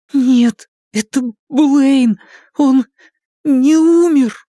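put-on high, tearful child voice; a scared boy near tears; frightened boy. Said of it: "губы дрожали, глаза блестели от слёз".

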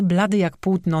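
ordinary delivery, nothing marked

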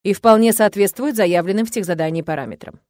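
ordinary delivery, nothing marked

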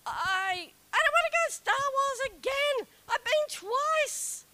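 put-on high whiny childish voice; whiny refusal. Wife whining at her husband about another ride, high childish whine.

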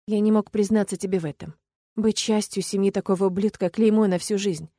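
usual speaking voice

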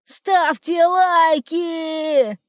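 very slow, high drawn-out shout. Acted. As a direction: yell it as the balloon drifts away. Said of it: "крикнул".

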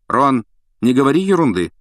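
stern fatherly put-down; sharp rebuke. A narrator's reading. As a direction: cut him short like a father. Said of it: "осёк его отец".

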